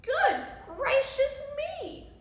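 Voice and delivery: high, loud, slow astonishment; hammed-up storybook shock; shocked exclamation. A narrator's reading. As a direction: exclaim it as if startled. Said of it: "and exclaimed".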